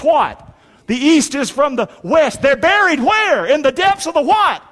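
high, excited quizzing; rousing call and response. Real, not acted. Preacher prompting congregation to finish verses, excited. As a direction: toss each question up high to the crowd.

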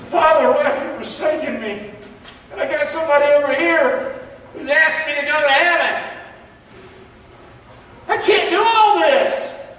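imagined Jesus. Retold or imitated. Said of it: "I can imagine him".

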